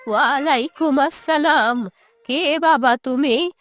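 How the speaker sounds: put-on drawn-out, quavering old mother voice. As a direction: answer as a wary old mother, words stretched.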